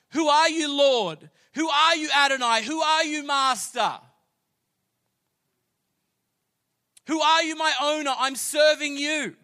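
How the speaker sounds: high, full-voiced shouted questions; loud, impassioned preacher's shout; crying out to God. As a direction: cry up to your master in awe. Acts Saul answering the heavenly voice.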